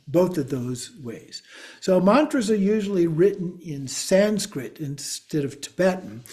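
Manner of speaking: ordinary talk, nothing marked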